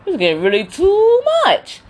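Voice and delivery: loud high mock alarm; shocked. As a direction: cry out at how far it went. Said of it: "I was like".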